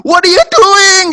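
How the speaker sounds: high, loud, stretched-out yell; imitated child's screaming. Toddler yelling at daddy over needles, screaming. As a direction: shriek it like a panicked toddler. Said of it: "dia tuh teriak-teriak".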